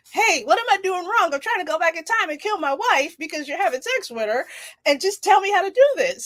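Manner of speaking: a lightly voiced frantic husband; desperate husband. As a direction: play the frantic husband begging for help. Husband asking professor, acted quote.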